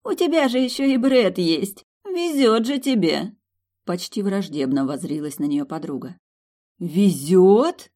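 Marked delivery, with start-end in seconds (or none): 0.0-3.4 high, slow admiring envy; delighted envy. A narrator's reading. Susie envying Milly her luck, high delighted gush. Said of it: "восхищённо округлив глаза". 6.8-7.9 loud indignant Везёт with a rise. A narrator's reading. Milly outraged at Susie, loud indignant Везёт. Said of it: "возмутилась Милдред".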